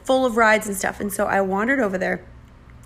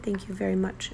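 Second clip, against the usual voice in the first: whispered "Thank you very much" to dismiss her; whispered brush-off; 8 dB quieter than usual. Retold or imitated. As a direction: breathe it out like a curt employee ending the chat. Brush-off from the employee.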